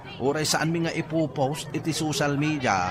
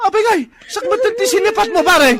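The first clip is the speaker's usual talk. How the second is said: high, loud, fast furious yelling; angry shouting; 9 dB louder than usual. Acted. Man yelling at his attacker mid-fight, fast high shouting. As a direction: scream the accusation at him.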